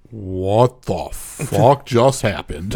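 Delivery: slow, stunned disbelief. Retold or imitated. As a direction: let the shock sink in slowly. Reacting to a shocking suicide.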